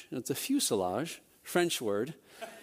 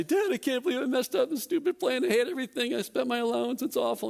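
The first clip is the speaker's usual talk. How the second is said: put-on much higher, a frustrated child's wail; be the boy who just ruined his model; upset kid; 9 semitones higher than usual. Boy complaining to his dad about the ruined plane, high whiny child voice.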